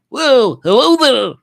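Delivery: very high, loud hooting greeting; Rob. Acted. Reading Rob's comment like he does, high loud impression. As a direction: belt out the squeaky high impersonation. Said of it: "Like he does".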